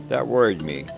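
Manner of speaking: ordinary delivery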